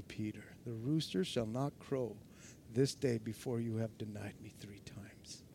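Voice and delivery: soft and low, ending in a whisper; hushed prophecy. A narrator's reading. Jesus's solemn prophecy.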